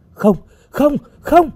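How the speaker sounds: high, frightened cries; panicked refusal. A narrator's reading. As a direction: plead in panic, voice leaping up. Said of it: "Hồng xua tay". (hoảng sợ kêu lên, giọng cao vút)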